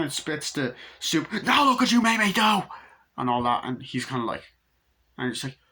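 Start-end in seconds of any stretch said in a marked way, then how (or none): 1.4-2.6 very high, loud blaming shriek; mock furious outburst. Imitating Annie blaming Paul for spilled soup, furious shriek. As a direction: explode at him in imitated rage. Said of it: "the rage scene is scary".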